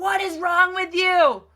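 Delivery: put-on sharp, high, loud snap at the child; frustrated parent. Parent losing patience with a misbehaving child, loud frustrated outburst. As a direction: blow up at the kid in frustration. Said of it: "instead of getting frustrated and be like".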